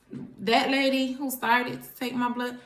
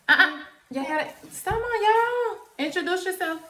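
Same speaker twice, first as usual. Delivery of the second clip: excited calling; 9 semitones higher, 4 dB louder than usual. Real, not acted. Mom calling her kids over.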